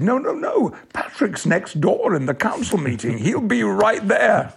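high-pitched, slowing protest on the first no and last line; hammed-up colleague saying no, no, no, he's covering; mock sub-editor. Sub-editor telling the editor Patrick is nearby, high mock voice.

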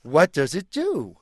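slow, wondering elephant voice; the elephant asks out of curiosity; curious elephant. Acted. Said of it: "me as the elephant".

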